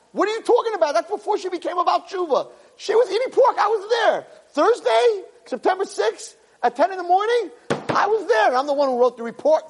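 high-pitched, indignant accuser; outraged Satan. Acted. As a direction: protest like a prosecutor whose evidence is ignored. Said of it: "he's freaking out".

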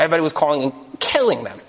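ordinary delivery

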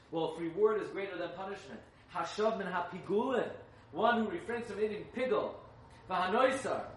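fiery preaching. Acted. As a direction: preach the text at full force. Preaching the Medrash at full force.

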